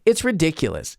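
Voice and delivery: usual speaking voice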